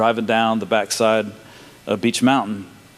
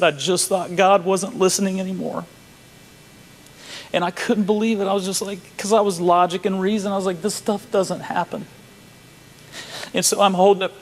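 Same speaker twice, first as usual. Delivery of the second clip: high, tearful voice; choked up; 7 semitones higher than usual. Real, not acted. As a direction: push the words out through real tears. Moved to tears retelling the story.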